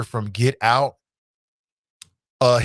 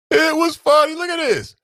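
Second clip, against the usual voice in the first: laughing shout; 12 semitones higher than usual. Real, not acted. Cracking up over tweets.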